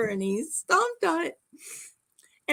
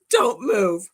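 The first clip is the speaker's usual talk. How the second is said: louder, laughing echo of the warning; mock loud echo; 6 dB louder than usual. Wife echoing her husband's warning loudly, half-laughing. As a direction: throw the order back with a laugh.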